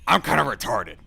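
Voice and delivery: loud gruff talk-radio ranter; Alex Jones impression. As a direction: rant it like a conspiracy radio host. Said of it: "to quote Alex Jones".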